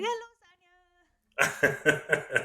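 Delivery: playful imitation in an Indian accent. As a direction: greet sing-song with an Indian accent. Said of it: "She kinda does it with an Indian accent".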